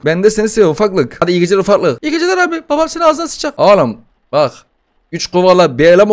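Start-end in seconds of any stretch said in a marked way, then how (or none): 2.0-3.6 a tiny brat swearing; squeaky baby voice. Pretend. Gamer voices the demon baby cursing him, squeaky.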